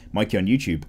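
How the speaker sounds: usual speaking voice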